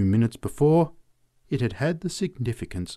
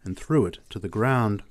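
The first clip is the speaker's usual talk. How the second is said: ordinary delivery, nothing marked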